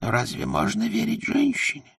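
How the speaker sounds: hushed, high-pitched confiding voice; furtive whisper. A narrator's reading. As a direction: whisper it secretly, then shush the listener. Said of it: "И он добавил шёпотом".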